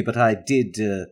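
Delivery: ordinary talk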